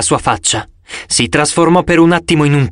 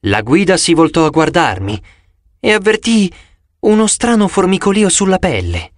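ordinary delivery

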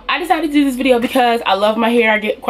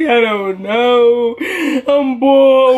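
drawn-out, louder retold wail; mock crying; 4 semitones higher, 3 dB louder, much slower than usual. Daughter answering mom about her hair, hammed-up crying. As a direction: whine it helplessly, as if sobbing. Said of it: "screaming, crying".

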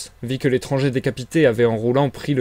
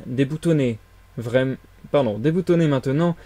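ordinary delivery

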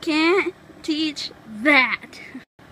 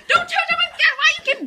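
laughing angry plea; 10 semitones higher, faster than usual. Real, not acted. Brother touching his toys.

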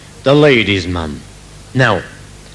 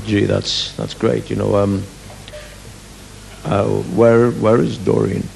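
lower, soft would-be suave; a touch of crooner; smooth chat-up voice; 2 semitones lower, 3 dB quieter, slower than usual. Acted. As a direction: play it cool and low. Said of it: "deep romantic voice".

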